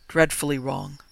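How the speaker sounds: plain reading voice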